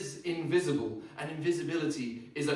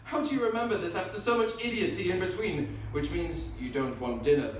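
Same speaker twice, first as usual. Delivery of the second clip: normal talking voice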